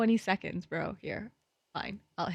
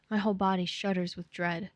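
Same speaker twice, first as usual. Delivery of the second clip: ordinary talk, nothing marked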